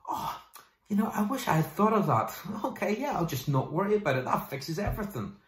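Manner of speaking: high, mocking fake gratitude with a laugh; sarcastic mock reply. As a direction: play the scoffing listener, pitch up and laugh. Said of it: "never got the response".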